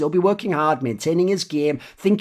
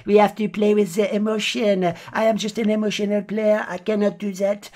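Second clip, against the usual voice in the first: mock French player; 5 semitones higher than usual. Mocking emotional French players.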